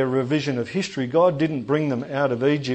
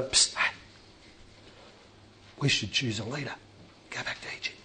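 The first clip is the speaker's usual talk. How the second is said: conspiratorial whisper; 10 dB quieter, faster than usual. Acted. Israelites plotting in secret.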